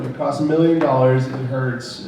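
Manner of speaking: normal talking voice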